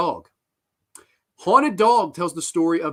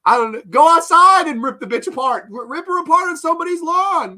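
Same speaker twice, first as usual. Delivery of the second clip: loud, high, furious yelling; furious rant; 10 semitones higher, 8 dB louder than usual. Real, not acted. Fed-up reviewer yelling advice at the filmmakers. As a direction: tear into them at full volume.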